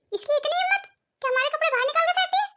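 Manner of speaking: how dare she, spat out in outrage; outraged. Acted. Mother-in-law fumes about daughter-in-law throwing clothes, outraged.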